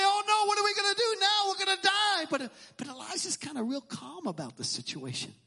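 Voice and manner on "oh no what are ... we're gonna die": higher and fast, acting out panic; mock panic. A listener imagining Elisha's reaction, in quick mock panic. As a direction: rush it like the panic button is pushed. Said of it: "push the panic button".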